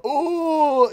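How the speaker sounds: high strained groan; mock anguished groan; groan as Golden Freddy. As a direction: voice the robot slumping. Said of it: "So he's like".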